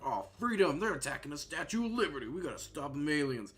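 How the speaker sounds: high, loud hammed-up patriotic American; mock American hero. Mocking the pinball game's American voice actor, hammed-up patriotic shout. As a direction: belt it like a cheesy gung-ho American. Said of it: "'cause that one's like".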